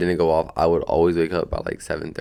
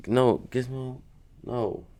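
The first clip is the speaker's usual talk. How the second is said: higher gentle scold to the cat; talking to a pet; 6 semitones higher, 4 dB quieter, slower than usual. Real, not acted. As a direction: coax the cat away.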